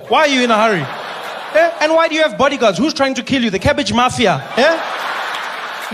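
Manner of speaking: loud, high-pitched comic scolding; indignant rant. Acted. Citizen berating a minister in a hurry, indignant rant. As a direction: berate them in mock outrage.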